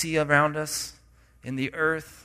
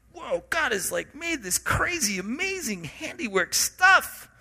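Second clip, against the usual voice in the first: higher, amazed whoa at the world; awed wonder; 8 semitones higher than usual. Retold or imitated. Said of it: "'cause you're like".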